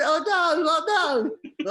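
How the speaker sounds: high, loud retold wail; mock dismay. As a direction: imitate a horrified friend crying out. Friend's dismay at the accident.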